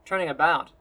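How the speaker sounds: ordinary delivery, nothing marked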